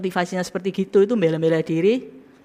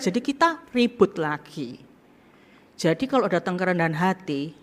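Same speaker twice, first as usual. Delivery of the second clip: ordinary talk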